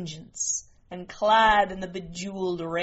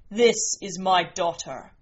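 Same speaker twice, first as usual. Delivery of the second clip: louder, declaiming alien father; proud Martian giant; 5 dB louder than usual. Acted. Green Martian introduces his daughter to the queen, forceful and proud. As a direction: present her formally, with some force.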